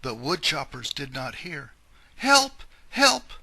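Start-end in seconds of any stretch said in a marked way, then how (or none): 2.2-3.3 high, loud screams for help; cry for help. Acted. Grandmother screams again.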